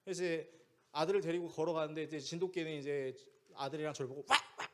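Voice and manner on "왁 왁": short, sudden mock barks; imitated dog bark. Jindo dog barking at father and son, imitated barking. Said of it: "막 짖는 거죠".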